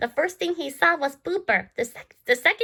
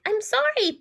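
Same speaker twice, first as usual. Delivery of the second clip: ordinary delivery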